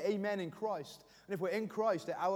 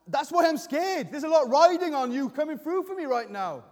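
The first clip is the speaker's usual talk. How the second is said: raised, urgent pleading with God; emotional prayer; 3 semitones higher, 6 dB louder than usual. Acted. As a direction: build to a forceful, higher plea. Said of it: "Do you bring that kind of emotion".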